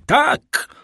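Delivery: very high, despairing outburst; acted shriek of despair; anguished cry. A narrator's reading. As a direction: cry it out at the top of the range. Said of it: "zawołał Villefort, rwąc sobie włosy".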